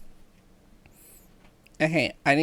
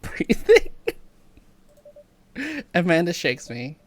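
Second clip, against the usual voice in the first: normal talking voice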